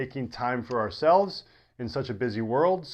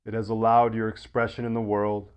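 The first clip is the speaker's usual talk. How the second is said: guided meditation; 4 semitones lower than usual. Acted. Yoga teacher settling listeners into deep rest, guided meditation. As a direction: ease them gently into rest, low and unhurried.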